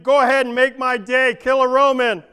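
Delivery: loud, rabble-rousing battle cry; rousing shout. Acted. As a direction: shout it like a zealot rally. Zealot bumper sticker, shouted.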